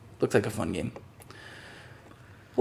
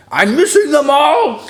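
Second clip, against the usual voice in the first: mock lament; 6 dB louder, slower than usual. Real, not acted. Mock lament about Europe.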